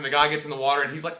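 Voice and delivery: ordinary delivery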